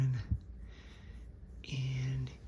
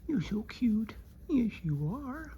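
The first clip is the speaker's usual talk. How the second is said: higher cooing pet voice; sweet praise for a puppy; talking to a dog; 7 semitones higher than usual. Real, not acted.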